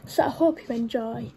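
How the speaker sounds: plain reading voice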